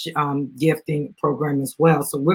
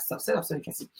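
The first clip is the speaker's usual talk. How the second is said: whispered aside; 3 semitones lower, 14 dB quieter, much faster than usual. Real, not acted. Aside to child during live.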